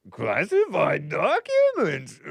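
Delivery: slow, strangled voice with leaping pitch; mock the words like a little creature; exaggerated dim-witted mockery; Yoda. Shown as a demonstration. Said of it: "you do a decent Yoda impression".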